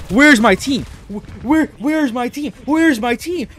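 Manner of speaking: loud, high-pitched frustrated rant; exasperated yelling. Real, not acted. Player hunting for his missing team, exasperated yelling. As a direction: yell high at useless teammates.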